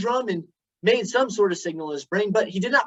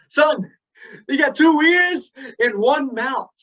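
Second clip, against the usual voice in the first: a slow, higher motherly voice; mama's voice; 6 semitones higher, 5 dB louder, slower than usual. Retold or imitated. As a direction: quote Mama slowly and wisely. Speaker voices his mother.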